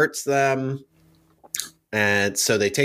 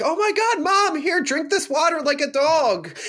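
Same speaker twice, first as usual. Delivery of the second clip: high-pitched panicked fussing, hammed up; exaggerated worried squeal; mock frantic concern; 13 semitones higher than usual. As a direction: fuss over Mom in a much higher voice. Acts out everyone fussing over Mom.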